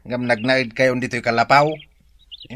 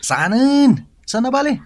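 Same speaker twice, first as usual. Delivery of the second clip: pitched-up incredulous questions; shocked high retort; 9 semitones higher, 4 dB louder than usual. Acted. Wife stunned by his refusal, high incredulous retort. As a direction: throw back a stunned, high "what?".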